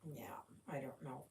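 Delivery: hushed whisper so Lily Bell stays asleep. Real, not acted. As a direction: breathe it out under your breath. Not waking sleeping Lily Bell.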